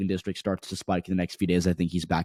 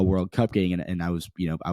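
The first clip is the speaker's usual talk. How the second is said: usual speaking voice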